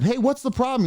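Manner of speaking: mother challenging the man, firm; mom confronting. Retold or imitated. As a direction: mom stepping in to protect.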